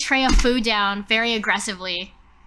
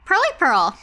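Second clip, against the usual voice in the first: lightly voiced, a bit higher and brighter; Flora's bright greeting; 6 semitones higher, 4 dB louder than usual. Flora breezily asks Pearl at breakfast, lightly voiced. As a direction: tease out the old nickname. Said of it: "so I say".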